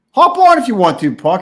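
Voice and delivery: high, loud call to come on. Real, not acted. Streamer calling Puck to hop on, loud call. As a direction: shout him onto the stream.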